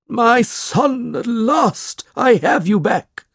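high, drawn-out theatrical cry; overjoyed father. A narrator's reading. Snawley to the boy he claims as his son, gushing. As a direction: gush over a long-lost son in a put-on voice. Father acting out tearful reunion.

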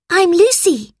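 put-on high-pitched girl's reply; young girl answering. A narrator's reading. Lucy introduces herself to teacher, high girlish voice.

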